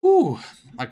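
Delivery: loud winded exhale; breathless whew. Real, not acted. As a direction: blow out a tired whew. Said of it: "running out of breath here".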